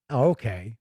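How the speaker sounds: usual speaking voice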